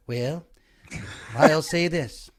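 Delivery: quick presidential impression, drawn-out opening word; writers' room presidential impression; Reagan. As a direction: play the president weighing in with a folksy "well". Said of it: "we were all doing Reagan".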